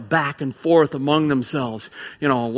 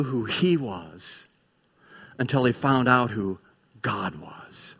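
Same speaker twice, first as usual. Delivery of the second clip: much softer, trailing into a whisper; hushed; 5 semitones lower, 6 dB quieter than usual. Real, not acted. Preacher to congregation about Isaiah, hushed whisper. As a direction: drop to a whisper for awe.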